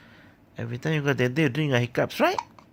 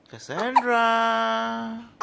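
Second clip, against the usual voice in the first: drawn-out calling; much slower than usual. Real, not acted. Parent calling baby Cassandra, slow sing-out call. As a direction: call slowly, holding the last vowel.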